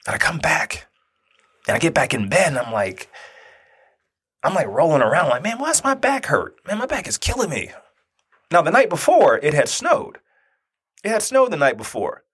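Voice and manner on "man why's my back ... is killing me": high-pitched retold groggy complaint; pained complaint. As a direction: act out a puzzled whine about pain. Acting out waking with back pain.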